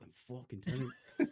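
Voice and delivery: half-laughing mock threat at the remote. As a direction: warn the remote through a laugh. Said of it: "deadly for threatening things".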